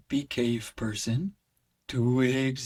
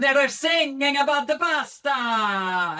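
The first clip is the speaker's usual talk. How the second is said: high, loud operatic tenor voice; Italian tenor; 11 semitones higher, 6 dB louder than usual. Shown as a demonstration. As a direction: belt it like a hammy Italian tenor. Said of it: "pretend to be Italian tenors".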